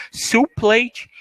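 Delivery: "Soup plate" as one smooth unit, no pause between the words; one block. Shown as a demonstration. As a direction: join "Soup plate" into a single sound block. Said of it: "não pausem no meio". ("Soup plate" como um único bloco de som)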